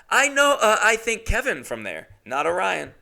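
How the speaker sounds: lightly dopey reread; mock reread. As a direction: mimic him sounding clueless. Rereading the text.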